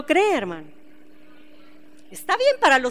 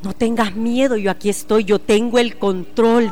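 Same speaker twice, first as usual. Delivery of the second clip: low, steady comfort, slowing at the end; calm reassurance; 5 semitones lower than usual. Acted. God's reassurance, first take lower.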